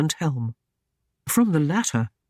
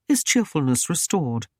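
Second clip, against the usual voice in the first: ordinary delivery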